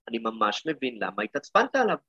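normal talking voice

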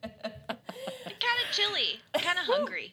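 high, slow goofy voice; silly high voice. Pretend. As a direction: drawl it out in a silly voice.

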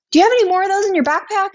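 high, fast eager question; excited shop owner. Retold or imitated. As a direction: rush it out, thrilled to find stock. Shop owner eager, retold quote.

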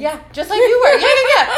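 high, loud laughing tease. Real, not acted. Teasing her friend about Christmas, laughing loudly. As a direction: cackle through a playful jab.

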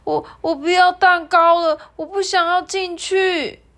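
high, loud, stammering little koala pleading; a frightened child begging to be let go; 害怕的小孩声音; scared child. Acted. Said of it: "团团就有点害怕了".

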